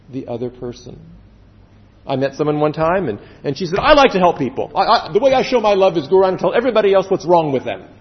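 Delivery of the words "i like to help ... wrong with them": fast, louder mimicry of a busybody; imitated gushing, hurried fault-finder; overbearing do-gooder. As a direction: rattle it off as a pushy helper. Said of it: "Well, that frightened me".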